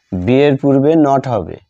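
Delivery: ordinary delivery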